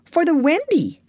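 put-on high, bright-eyed boyish reply; eager answer. A narrator's reading. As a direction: answer as if it were obvious. Boy proudly answers a question.

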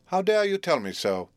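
high, affronted mother's rebuke; haughty, high-pitched scolding; indignant retort. A narrator's reading. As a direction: snap back at an impertinent child. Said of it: "retorted Missus Pocket".

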